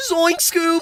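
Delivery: high-pitched cartoon slacker cry; Shaggy. Friend doing Shaggy calling Scoob, high cartoon voice.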